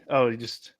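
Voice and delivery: ordinary delivery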